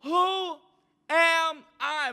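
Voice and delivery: high, pleading Moses voice; whiny Moses. Retold or imitated. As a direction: plead with God in a high, small voice. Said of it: "Moses is like".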